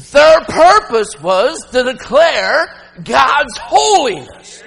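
slow ringing shout of praise; proclaiming shout. Acted. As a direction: proclaim it slowly to the back row. Preacher proclaims seraphim's purpose.

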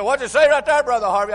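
put-on high, loud challenge from an old preacher; old preacher's challenge. Old preacher loudly challenging young Brother Harvey, shouting. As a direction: call him out loudly from the pulpit. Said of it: "He said".

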